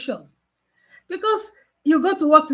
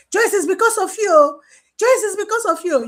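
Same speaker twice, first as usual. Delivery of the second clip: higher, louder accusing cry at the nurse; loud blaming; 6 semitones higher, 4 dB louder than usual. Retold or imitated. Patient loudly blaming nurse Joyce for starting it, raised accusing voice. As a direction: shout the blame at her.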